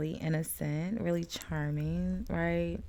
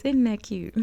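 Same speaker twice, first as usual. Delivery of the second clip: put-on higher, louder country girl twang; country girl cooing, pitched up and punchy; 5 semitones higher, 6 dB louder than usual. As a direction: play up the country girl accent a bit. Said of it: "In my country girl voice".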